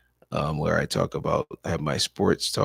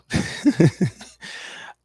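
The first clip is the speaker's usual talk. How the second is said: tired laugh; 11 semitones higher, 3 dB louder than usual. Real, not acted.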